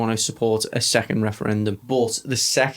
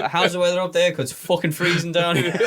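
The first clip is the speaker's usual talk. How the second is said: mock Scottish accent, higher; 6 semitones higher than usual. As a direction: put on a jokey Scottish accent. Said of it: "Is a Scottish accent".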